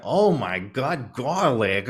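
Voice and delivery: high, loud, drawn-out mock amazement; imitated audience member. Mocking a vocal Broadway spectator, hammed-up loud exclamation. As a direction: stretch it out like a hammed-up, thrilled theatergoer. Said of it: "this lady in the sort of third row".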